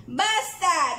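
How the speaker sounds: ordinary delivery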